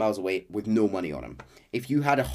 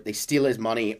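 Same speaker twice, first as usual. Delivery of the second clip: normal talking voice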